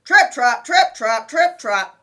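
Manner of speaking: booming stomping hoofbeats; loud heavy trip trap. A narrator's reading. Big goat crossing heavily.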